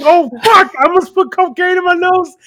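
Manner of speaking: high, loud alarmed; startled at himself; amused mock shock; mock realization. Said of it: "I'm like".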